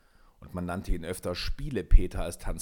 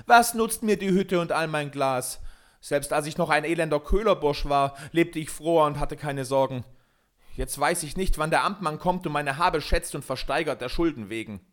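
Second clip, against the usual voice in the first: loud, raised voice full of blame; heated, higher-pitched lament; angry complaint; 5 semitones higher, 6 dB louder than usual. A narrator's reading. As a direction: rail against your misfortune. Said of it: "geriet er in Zorn und Eifer".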